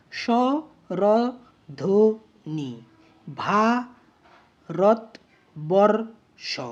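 স্বরধ্বনি and ভারতবর্ষ very slowly, each in four pieces; syllable by syllable. Shown as a demonstration. Teacher has students count four units in স্বরধ্বনি, ভারতবর্ষ, syllable by syllable. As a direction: sound out ভা, রত, বর, ষ and স্বরধ্বনি likewise, with gaps. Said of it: "চারটে দল পাচ্ছি দেখো".

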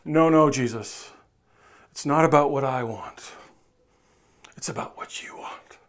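low, falling to a whisper; mock pious reply. Bartimaeus refusing Jesus, mock pious and hushed. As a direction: play the over-spiritual answer. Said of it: "notice Bartimaeus doesn't go".

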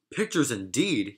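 ordinary delivery

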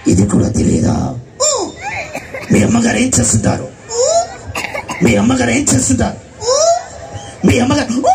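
Aparichitudu voice. Retold or imitated. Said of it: "ఎలా మాట్లాడుతాడు చూడండి".

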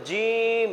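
জিম with a thick j, drawn out; thick, heavy. Shown as a demonstration. Teacher repeats the model, জিম thick and held. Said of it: "মোটা করে পড়তে হবে".

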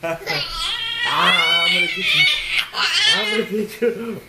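very high, loud laughing monster cry; shrieking bear pounce. Acted. Dad pouncing on the kids as the bear, shrieking laughing monster. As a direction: pounce with a squealing roar. Bear tickle game climax.

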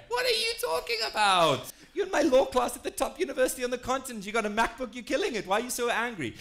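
high, loud, exasperated reenactment; indignant outburst. Retold or imitated. Acting out his outburst at classmate.

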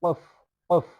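কফ with an echoing burst of sound; qalqala qaf. Shown as a demonstration. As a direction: make the q of কফ pop audibly. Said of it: "একটু কলকলাভাবে উচ্চারণ করবেন".

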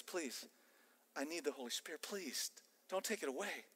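soft, earnest begging; hushed plea. Acted. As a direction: plead quietly as if in prayer. Voices David pleading with God.

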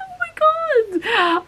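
very high, drawn-out gasp of delight; excited squeal. Retold or imitated. Vlogger retelling seeing the parcel, thrilled squeal.

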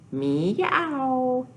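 high playful meow; cat meow for kids; kitty meow. Pretend. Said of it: "What do kitties say".

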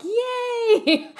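high, loud gleeful yay; retold cheer. Coach cheering when clients rule something out, gleeful cheer. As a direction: cheer it out with a laugh. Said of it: "I'm like".

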